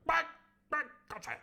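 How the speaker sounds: high, stammering posh exclamation; Squire's catchphrase. Acted. The Squire blustering his catchphrase, high and stammering. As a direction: sputter it like a pompous toff. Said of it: "All the whole way through".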